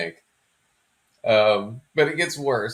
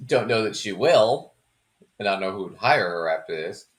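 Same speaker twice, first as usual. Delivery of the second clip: ordinary talk, nothing marked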